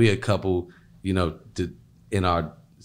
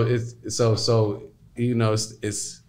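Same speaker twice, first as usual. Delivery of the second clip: ordinary talk, nothing marked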